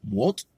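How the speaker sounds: "what" said as rising "mwolt"; "what" as Korean mwo, rising; pronunciation heard before. Shown as a demonstration. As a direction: say "what" as one syllable, "mwolt". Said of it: "it's been like".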